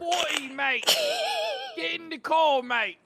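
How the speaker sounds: mock British yell. Officer replaying the suspect's shouting in court, mock British. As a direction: yell it like a drunk Londoner in the street.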